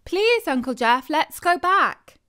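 put-on pleading kid voice; pleading child. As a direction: beg to turn back. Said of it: "the kids were pleading".

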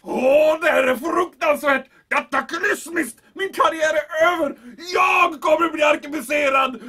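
loud, high panicked wailing; hammed-up despair, shouting; panicked outburst. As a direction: cry out that his career is over. Commandant panics over his career.

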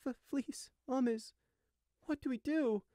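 high, halting whimper; Gossamer whimpering. A narrator's reading. Small sheep asks his brother for help, whimpering. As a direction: whimper helplessly, breaking off. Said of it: "Gossamer whimpered".

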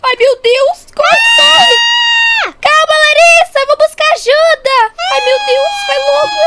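high, loud, frantic cries; panicked shouting. Acted. Girl running to get help for her sister, panicked shouting.

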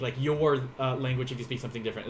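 ordinary delivery

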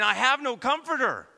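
high, loud lament of a widow; grieving widow. Acted. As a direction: cry it out like a bereaved widow. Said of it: "the imagery of the widow saying".

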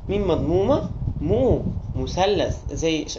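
"مو" held slow and clear before "مثلث"; slow sounding out mu. Shown as a demonstration. Teacher to children, slowly sounding out "مو مثلث". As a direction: spell "مو" as its own syllable, then "مثلث".

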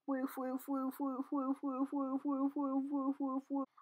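soft, even rapid woofs; barking. Acted. Acting a dog in a drawing, steady soft woofing.

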